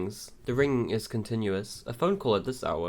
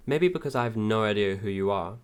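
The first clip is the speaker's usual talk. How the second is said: ordinary talk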